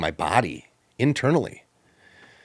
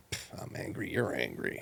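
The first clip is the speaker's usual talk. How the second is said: sulky mutter; 10 semitones lower, 14 dB quieter than usual. Retold or imitated. Retold sulky inner thought.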